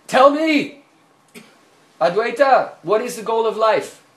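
loud, high, demanding challenge; the guru angrily confronts his disciple; angry demand. A narrator's reading. Mahaprabhu angrily demanding an answer from Advaita. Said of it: "Mahaprabhu became more angry".

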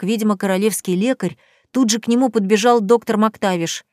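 ordinary delivery

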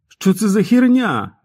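high, loud, slow furious yell; raging. A narrator's reading. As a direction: rage at the top of your voice. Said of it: "лютував пацан".

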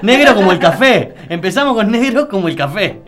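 loud and laughing through the words. Real, not acted. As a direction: crack up while you repeat it. Laughing repeating the punchline. (riéndose a carcajadas)

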